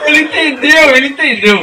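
laughing. Real, not acted. Laughing at a friend's joke, high and loud. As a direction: crack up while repeating it.

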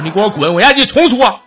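hammed-up boss demanding a redo; mock angry boss. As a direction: snap it like an annoyed boss. Boss demands a proper answer.

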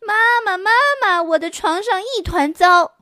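put-on a little girl yelling for her mom; shouting girl. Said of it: "雪莉大叫着跑下楼".

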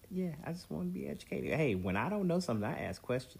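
high, fast playful protest. Real, not acted. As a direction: play innocent, quick and high. Playful defence after teasing.

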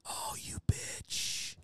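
slow, whispered accusation; stunned whisper. Retold or imitated. Songwriter hearing his melodies in her songs, accusing whisper. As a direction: whisper it under your breath, drawn out.

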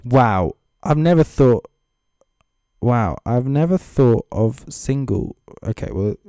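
ordinary talk, nothing marked

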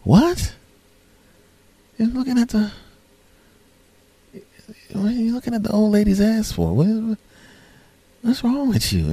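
very high, thin incredulous voice; hammed-up falsetto bewilderment; mock disbelief. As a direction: squeak it out as if appalled at a friend. Stunned friends confront the guy.

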